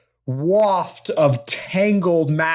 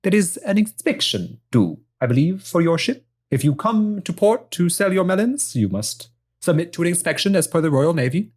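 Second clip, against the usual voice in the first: put-on lightly done Spanish accent, officious; an officious lieutenant with a light Spanish accent; 4 semitones higher than usual. Lieutenant announcing the inspection to the ship, in a light Spanish accent. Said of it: "a Spanish accent".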